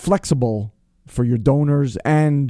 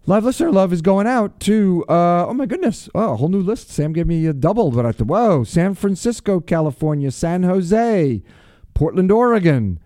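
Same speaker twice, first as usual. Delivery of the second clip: radio shout-outs; 5 semitones higher than usual. Acted. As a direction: call out each city with a showman's lift. Shout-outs to listener cities.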